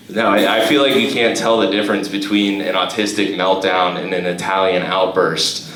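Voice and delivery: ordinary talk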